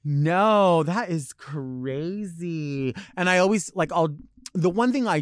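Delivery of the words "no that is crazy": drawn-out mock amazement; polite, insincere wow; fake amazement. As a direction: stretch it slowly, feigning shock. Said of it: "Fake laughing".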